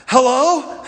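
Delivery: put-on puffing child answering the phone; mock panting. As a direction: pant audibly around the word, imitating a winded kid. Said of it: "and was panting".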